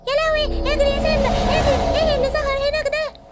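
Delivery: high shrieking fear, telling Simba to run; panicked scream. Acted. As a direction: scream in terror. Panic on seeing giant. (صرخة رعب عالية)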